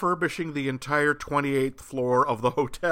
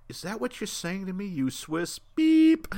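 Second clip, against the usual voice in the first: higher voice with long loud "beep"; character's hostile thought; 7 semitones higher, 4 dB louder than usual. Acted. Broker silently challenging the Swiss banker, mock hostility. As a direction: act out an insulting inner retort, censor beep included. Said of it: "you hear inside their heads".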